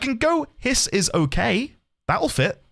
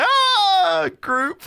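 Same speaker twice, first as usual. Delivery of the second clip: high yelp melting into a laugh; playful shriek into giggle; mock cry breaking into laughter; 12 semitones higher, 3 dB louder than usual. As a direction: squeal mid-sentence then crack up. Inserting scream into game line.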